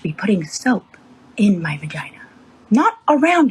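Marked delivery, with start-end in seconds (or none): 1.6-2.2 whispered. Real, not acted. Telling the shower story, whispering the embarrassing word. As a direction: drop to a whisper on the awkward word.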